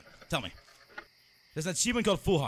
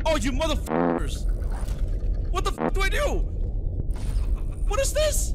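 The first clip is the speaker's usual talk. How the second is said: loud yell climbing ever higher; exasperated yelling; 8 semitones higher, 6 dB louder than usual. Real, not acted. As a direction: shout in rising disbelief. Panicked outburst during scary game.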